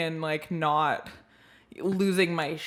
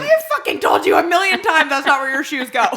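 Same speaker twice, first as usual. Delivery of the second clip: louder exasperated parent scolding, half-laughing; mock angry mom; 6 semitones higher, 5 dB louder than usual. Mom scolding kid about shoes, mock-angry rant. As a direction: bark at the kid in fake fury.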